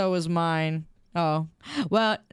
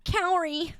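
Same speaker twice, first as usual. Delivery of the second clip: imitated caller; 10 semitones higher than usual. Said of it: "People like".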